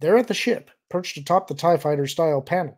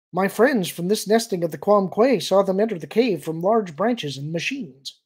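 raised, louder alien voice; earnest young alien; 5 semitones higher, 5 dB louder than usual. Acted. Alien relays his friends' sighting, earnest. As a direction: report it earnestly in a higher voice.

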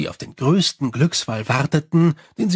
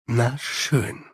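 lower, softer Na schön; Angua, giving in; 4 semitones lower, 3 dB quieter than usual. Acted. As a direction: concede Na schön low and quiet. Angua the werewolf gives in.

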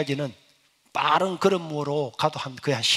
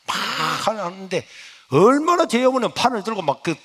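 ordinary talk

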